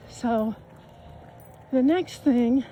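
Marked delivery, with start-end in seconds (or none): 1.7-2.6 out of breath. Real, not acted. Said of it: "oh, sorry, I'm out of breath".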